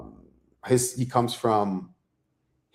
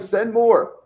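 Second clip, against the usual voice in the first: much higher, eager buddy voice; mock eager friend; 13 semitones higher, 5 dB louder than usual. Friends wanting the next part of the story, high mock eager voice. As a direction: pipe up like a friend begging for more.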